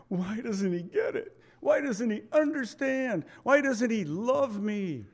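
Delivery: high, soft, plaintive questions of a hurt wife; preacher voicing a hurt wife, high and plaintive; wife's pleading thoughts. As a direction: ask it like a wife who feels unheard. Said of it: "women will look at us and think".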